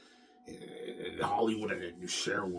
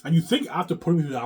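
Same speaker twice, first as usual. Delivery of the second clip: angry outburst; 8 semitones higher, 10 dB louder, faster than usual. Real, not acted. Angry rant at viewers.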